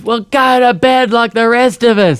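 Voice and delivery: high, drawn-out mock holler; yelling lady neighbour. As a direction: imitate a woman calling across backyards. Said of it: "a lady across the way who yelled out".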